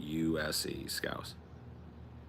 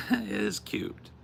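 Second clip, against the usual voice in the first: laughing; 13 semitones higher, slower than usual. Real, not acted. Laughing at own failed accent.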